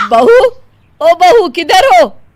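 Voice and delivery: loud, high call across the house, drawn out slowly; mother-in-law calling for her bahu, long and loud. Acted. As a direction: call for the daughter-in-law from another room.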